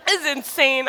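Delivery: "this is insane." said through a laugh. Retold or imitated. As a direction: laugh it out in happy disbelief. Laughs at husband's words.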